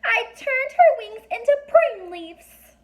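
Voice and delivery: very high, tiny, squeaky fairy voice; a little fairy snapping at strangers, high and squeaky. A narrator's reading. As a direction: speak like a fairy only inches tall. Fairy voice held.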